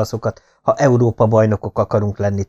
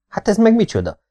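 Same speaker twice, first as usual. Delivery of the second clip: startled; 10 semitones higher than usual. Acted. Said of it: "kérdi ijedten".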